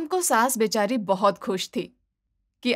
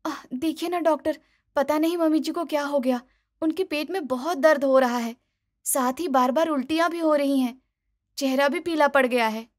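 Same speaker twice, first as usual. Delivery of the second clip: higher, fast anxious pleading; worried daughter-in-law; 4 semitones higher, faster than usual. Acted. Daughter-in-law to doctor, worried: fast, high. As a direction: rush the worry out.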